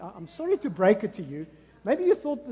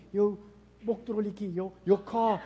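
ordinary delivery

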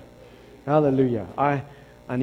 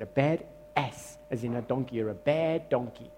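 usual speaking voice

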